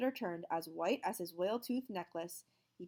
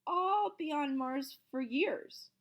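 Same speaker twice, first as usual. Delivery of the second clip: slow reveal; 8 semitones higher, 5 dB louder, much slower than usual. A narrator's reading. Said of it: "said Mister Jupiter".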